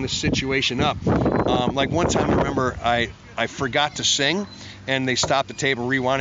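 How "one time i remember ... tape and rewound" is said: ordinary delivery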